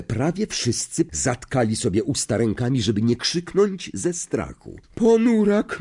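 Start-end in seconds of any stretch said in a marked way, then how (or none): 4.9-5.8 high, loud seer's cry. A narrator's reading. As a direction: announce the doom with a shriek. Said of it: "zawołała profesor Trelawney".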